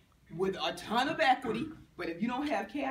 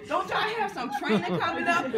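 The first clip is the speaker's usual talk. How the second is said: laughing tease; 7 semitones higher, 6 dB louder than usual. Retold or imitated. Husband teasing about trainings.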